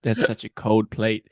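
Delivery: slow, breathy drawn-out whine about the cold plate; a slow, whispered moan as the fridge; fridge complaining. Pretend. Fridge moaning slowly to its owner about a cold plate. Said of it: "the fridge was like".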